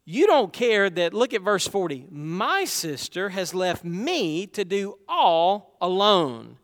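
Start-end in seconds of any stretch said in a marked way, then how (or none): 2.1-6.6 slow, stress on "My" and "all"; slow, deliberate stress on "all"; emphatic reading. Shown as a demonstration. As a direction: lean on "My sister" and "all alone". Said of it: "Notice the emphasis in what she says".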